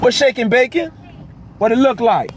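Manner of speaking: high, breezy pretend phone chat; mock phone call. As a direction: toss off a fake call in a high voice. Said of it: "I was so rudely interrupted".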